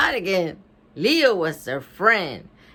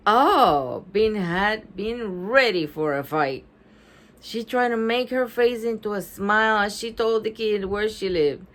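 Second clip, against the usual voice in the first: usual speaking voice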